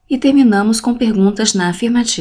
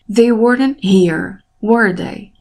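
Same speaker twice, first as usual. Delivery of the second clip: confirming tag, "were" raised and "they" lowered; falling intonation; slower than usual. Shown as a demonstration. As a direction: stress final "were" and let "they" drop. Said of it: "A voz fica mais alta em were".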